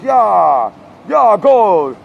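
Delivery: high, loud hollered approval; mock crowd cheer. Crowd answering the bad rapper, mock cheering.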